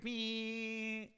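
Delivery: long, high steady engine whine; imitated engine buzz. Preacher mimicking a sports car stuck in first gear, droning engine noise. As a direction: hold a buzzing car-engine drone. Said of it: "he's buzzing along in first gear".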